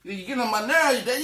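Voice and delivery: put-on high child's complaint; whiny kid voice. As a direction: son complaining to his dad. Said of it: "he's like".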